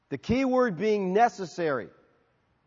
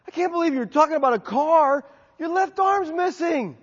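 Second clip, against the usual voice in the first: high, louder disbelieving voice; incredulous bystander; 10 semitones higher, 4 dB louder than usual. Acted. Bystander telling driver his arm is gone, high incredulous voice. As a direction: play the shocked onlooker.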